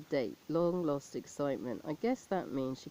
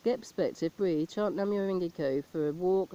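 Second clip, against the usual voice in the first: usual speaking voice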